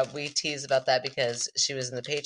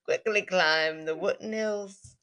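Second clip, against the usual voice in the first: British accent; 4 semitones higher, 4 dB louder than usual. Shown as a demonstration. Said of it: "I should say it in a British accent".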